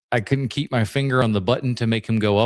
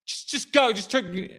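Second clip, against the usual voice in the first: flustered plea; 9 semitones higher, 4 dB quieter than usual. Retold or imitated. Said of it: "I was just like".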